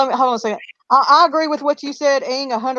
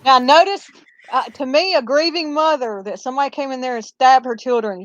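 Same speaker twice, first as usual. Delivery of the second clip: normal talking voice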